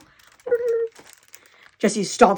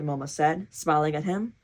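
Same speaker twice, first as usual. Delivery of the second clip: usual speaking voice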